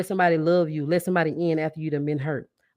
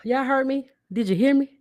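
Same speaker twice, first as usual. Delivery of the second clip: excited call-out; 9 semitones higher than usual. Real, not acted. Hyping listeners after a point.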